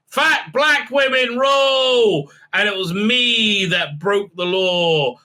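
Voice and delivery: high, slow, stretched-out shout; mock heroic proclamation. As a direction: bellow it like an overblown rallying slogan. Woman's mock heroic confession.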